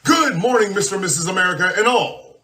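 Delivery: booming showman announcer voice; grand entrance announcement. Acted. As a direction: announce it like a big show opener. Show opening announcer intro.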